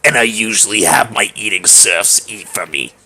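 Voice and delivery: low, slow, haughty drawl; Miranda. Acted. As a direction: draw each word out low and grandly. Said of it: "I'll do Miranda, the same voice".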